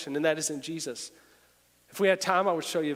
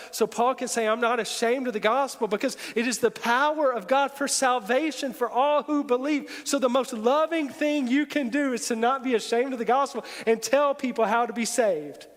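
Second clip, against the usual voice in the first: higher, louder preaching voice, pressing hard; full-force preaching; 6 semitones higher, 4 dB louder than usual. Acted. Pastor preaching to the congregation, defiant and loud.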